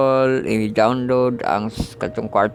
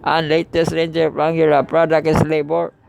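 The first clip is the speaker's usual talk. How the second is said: raised, measured Bible-reading voice; scripture reading; 5 semitones higher than usual. Acted. Teacher reading Psalm 3 to the class, raised scripture-reading voice. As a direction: read the psalm aloud to the class.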